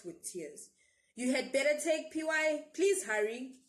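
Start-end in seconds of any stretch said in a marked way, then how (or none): 1.1-3.6 urgent mother. A narrator's reading.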